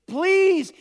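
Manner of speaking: a sudden high, loud cry of please; desperate pleading. Acted. A father begging Jesus to come, high pleading cry. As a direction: beg like a man on his knees. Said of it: "he's repeatedly pleading with Jesus".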